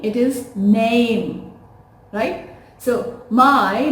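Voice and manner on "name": "name" slow, with the A vowel drawn out; stretched name. Shown as a demonstration. Teacher showing students a stretched "name". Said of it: "A has to be little stretched".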